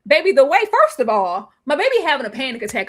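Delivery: ordinary talk, nothing marked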